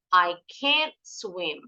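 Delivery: "can't" drawn out slowly, vowel lengthened; slow down and stretch the vowel of "can't"; long can't. Shown as a demonstration. Teacher shows pupils the slow negative "I can't swim". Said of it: "Bacanya agak lama".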